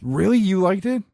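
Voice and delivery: high, rising disbelief; surprised. Real, not acted. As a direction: sound genuinely surprised. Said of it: "surprised follow-up".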